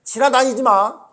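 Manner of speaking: loud, high, furious rebuke; Jesus's angry command. Retold or imitated. Jesus guarding God's temple from traders, angry command. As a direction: drive the intruders out with your voice. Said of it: "이랬던 예수님이에요".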